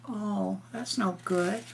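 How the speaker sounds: ordinary delivery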